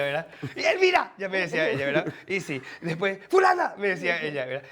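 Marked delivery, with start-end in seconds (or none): 0.6-1.1 high, loud mock teacher call; imitating Elvira. Student mimics teacher calling roll, high and loud. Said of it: "yo hacía la profesora Elvira". 3.3-3.7 high, loud mocking name call; imitating Elvira. Student mimics teacher Elvira calling a name, high and loud. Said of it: "yo hacía la profesora Elvira".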